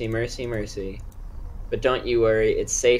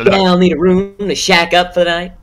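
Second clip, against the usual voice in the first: put-on old-timey drifter voice; 7 semitones higher, 7 dB louder than usual. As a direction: play a seventies wasteland drifter. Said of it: "why are you talking like it's nineteen seventy-three".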